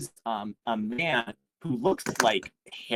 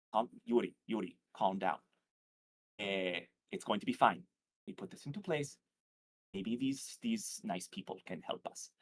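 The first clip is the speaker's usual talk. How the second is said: soothing; 3 semitones lower, 9 dB quieter than usual. Acted. Baba Eva soothing the mayor.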